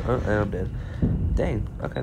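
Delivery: ordinary talk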